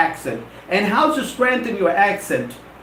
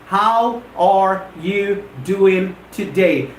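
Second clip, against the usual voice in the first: slow, separated words; much slower than usual. Shown as a demonstration. Teacher breaking the question into its words, word by word. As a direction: spell the question out one word at a time. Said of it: "the question comprises".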